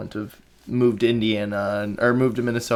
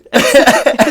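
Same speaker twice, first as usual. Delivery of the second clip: tearful laugh; 11 dB louder than usual. Real, not acted. Tearful interviewer laughs at himself.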